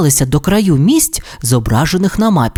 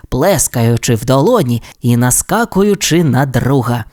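plain reading voice

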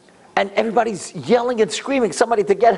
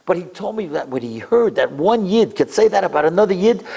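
ordinary talk, nothing marked

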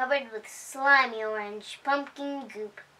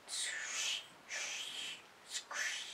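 softer, breathy swishing sound; whispered swish; 20 dB quieter, much slower than usual. A narrator's reading. Dragon walks through wet leaves, softer whispered swish.

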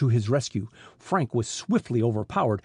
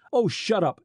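short, higher-pitched snap; snapped; 8 semitones higher than usual. A narrator's reading. Smuggler chief silencing the accusing detective, mildly snapping. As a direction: cut him off with mild irritation. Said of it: "Snackley snapped".

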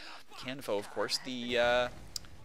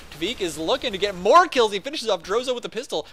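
louder, higher hype call; excited call; 7 semitones higher, 9 dB louder than usual. Real, not acted. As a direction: ride the kill streak. Kill streak in live cast.

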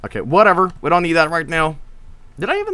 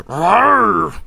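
higher-pitched drawn-out creature roar; Gorn roar; 4 semitones higher than usual. Retold or imitated. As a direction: roar like a Gorn that cannot talk. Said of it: "the Gorn can't really talk. He just goes".

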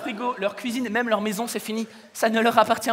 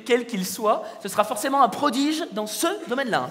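ordinary talk, nothing marked

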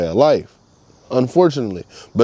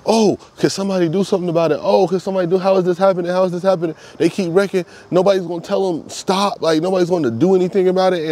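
imitating fretting townsfolk, pitched higher; mock worried residents; 7 semitones higher than usual. City residents begging for help with the wrecks, mock fretful higher voice. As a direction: play the panicked locals, a bit fast. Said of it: "a lot of people in the city were saying".

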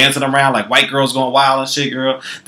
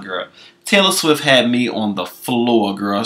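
ordinary delivery

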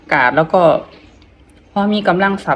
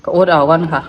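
ordinary talk, nothing marked

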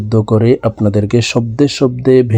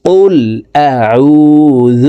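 أعوذ stretched long and sung; drawn-out model recitation; slow recitation demo; 4 dB louder, much slower than usual. Shown as a demonstration. Teacher modelling for students to repeat, slow chant. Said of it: "আমার মতো বলার জন্য চেষ্টা করুন".

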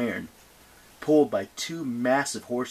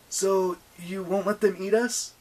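put-on higher-pitched young hero's question; voiced Percy asking; 9 semitones higher, 4 dB louder than usual. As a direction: lift the voice into a worried question. Percy anxiously questions the goddess.

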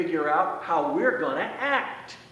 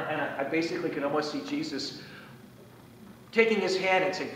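usual speaking voice

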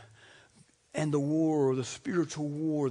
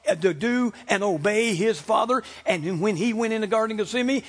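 high, driving pulpit delivery; forceful preaching; 5 semitones higher, 6 dB louder than usual. Real, not acted. Preacher tells congregation why Christ came, forceful preaching.

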